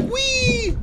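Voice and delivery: high playful squeal; silly high wee; squeaky wee. Pretend. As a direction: squeal like a kid. Playful swimming in game.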